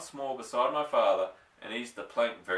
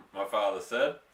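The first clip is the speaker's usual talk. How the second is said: ordinary delivery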